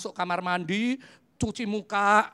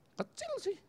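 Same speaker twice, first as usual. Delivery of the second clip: much higher, softer small voice; sheepish reply; 9 dB quieter than usual. Retold or imitated. Preacher admitting to the Spirit it was small, tiny high voice. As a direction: shrink into a tiny sheepish squeak.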